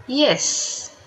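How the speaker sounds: ordinary delivery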